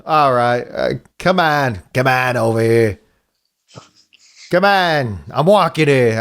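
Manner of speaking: stock Brooklyn tough-guy catchphrases; Brooklyn accent. Shown as a demonstration. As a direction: try out a drawn-out Brooklyn accent. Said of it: "what's the Brooklyn accent".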